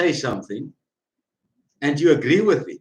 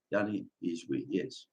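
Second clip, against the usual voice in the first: much softer, lower, almost to himself; soft echo; 6 semitones lower, 13 dB quieter than usual. Shown as a demonstration. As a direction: let the words fall away in a murmur. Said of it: "repeated as soft echo".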